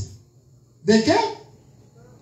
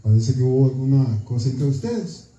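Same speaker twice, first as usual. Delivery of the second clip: pastor's gentle request; 10 semitones lower than usual. Retold or imitated. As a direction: ask the sister gently in a lower voice. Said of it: "le dije". (pastor pidiendo a la hermana, suave y grave)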